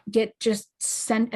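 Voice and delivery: ordinary talk, nothing marked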